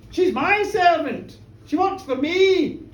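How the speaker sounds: loud, high, drawn-out insistence; indignant protest. Acted. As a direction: claim her emphatically as yours. Minister protests she is his servant.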